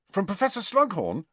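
high, eager rising question; eager lift on Slughorn; hopeful question. A narrator's reading. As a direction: ask it hopefully, pitched well up. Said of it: "sitting up hopefully".

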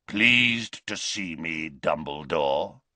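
slow, rasping taunt. A narrator's reading. Greyback taunting Dumbledore, rasping. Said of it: "rasped the other".

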